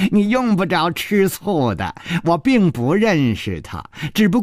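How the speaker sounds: a light laugh, then teasing; amused teasing chuckle. Acted. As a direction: laugh before teasing her. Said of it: "楚留香笑了笑说".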